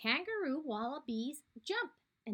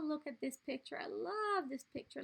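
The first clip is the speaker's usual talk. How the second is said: ordinary delivery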